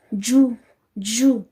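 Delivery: जू drawn out very slowly with a buzz; जू said with a long buzzing z; slow zoo. Shown as a demonstration. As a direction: stretch जू into a long zzz-oo. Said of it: "इसका sound ज नहीं".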